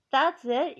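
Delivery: retold disgusted outburst; disgusted retort. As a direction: throw it back at her.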